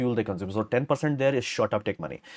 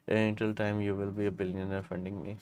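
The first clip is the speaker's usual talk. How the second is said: much softer, muttered-off repeat of the joke; 3 semitones lower, 8 dB quieter than usual. Real, not acted. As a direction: say it again under your breath. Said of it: "joke repeated softly after".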